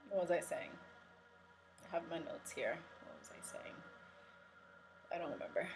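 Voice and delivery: soft, trailing self-talk; absent-minded and barely audible; talking to herself. Real, not acted. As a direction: drop right down while searching for the lost thread. Lost her thread, talks to herself.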